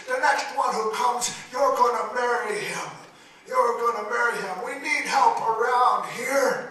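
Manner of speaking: loud, high, furious father's demands; father demanding his daughter marry; angry father shouting. Acted. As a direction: bellow orders at a stubborn daughter.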